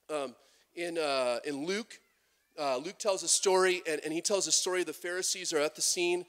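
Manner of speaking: normal talking voice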